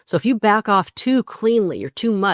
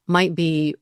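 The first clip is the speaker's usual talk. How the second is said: normal talking voice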